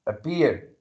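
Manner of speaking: "appear" with its peak at the P; stress on P. Shown as a demonstration. Teacher showing where the voice peaks, stressed P in "appear". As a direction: come down firmly on the P in "appear". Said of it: "you're stressing".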